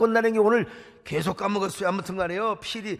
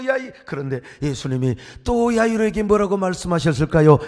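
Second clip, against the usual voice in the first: usual speaking voice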